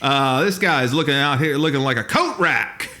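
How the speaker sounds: laughing teasing barker call; barker heckle. Retold or imitated. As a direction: tease the skinny guy. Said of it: "We were literally carnival barkers".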